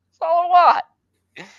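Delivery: loud, high-pitched desperate cry; fed-up plea boiling over; exasperated outburst. Real, not acted. Same plea repeated, escalating.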